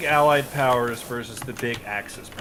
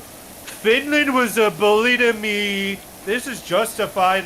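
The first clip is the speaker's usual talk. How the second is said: higher, slow, whining mock voice; whiny Soviet Union; 9 semitones higher, 4 dB louder, slower than usual. Mocking the Soviets' excuse for invading Finland, in a slow whiny higher voice. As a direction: play the whiny bully claiming to be the victim.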